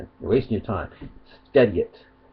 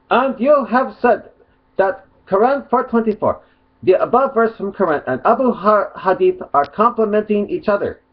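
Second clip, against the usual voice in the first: mock Arabic accent; 9 semitones higher, 3 dB louder than usual. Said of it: "I'll do it in a mock Arabic accent".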